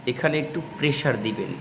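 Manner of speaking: ordinary talk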